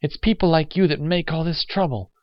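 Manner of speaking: high-pitched woman's accusation; horrified reproach. Acted. Woman rebukes the old man, horrified. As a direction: blame him, appalled.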